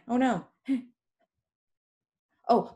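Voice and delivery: ordinary delivery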